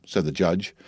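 plain reading voice

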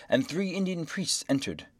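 plain reading voice

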